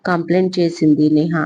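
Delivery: usual speaking voice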